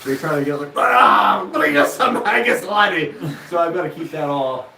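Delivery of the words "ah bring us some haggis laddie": loud, slow mock Scottish brogue; Scottish accent. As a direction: ham up a Scottish laddie. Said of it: "the Scottish accent of the eyebrows".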